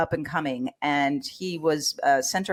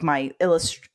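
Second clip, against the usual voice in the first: usual speaking voice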